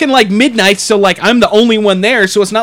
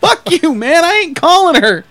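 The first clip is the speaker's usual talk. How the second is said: higher-pitched mock indignant comeback; indignant refusal; 6 semitones higher than usual. Manager pushing back at the drunk man, retold indignant refusal. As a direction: retell a defiant refusal, pitched up.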